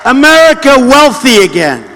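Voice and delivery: booming slogan delivery to a roaring crowd; rally speech. Real, not acted. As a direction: punch each promise out to the crowd. Rally crowd, chanted campaign slogans.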